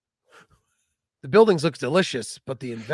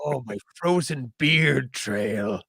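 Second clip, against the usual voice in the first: put-on slow old ghost; 3 semitones lower, much slower than usual. Voicing cold ghost.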